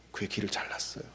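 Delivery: hushed whisper. Real, not acted. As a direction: breathe it like a secret. Dramatic hushed retelling.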